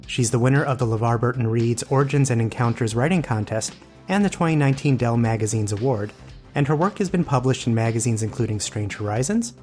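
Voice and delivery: plain reading voice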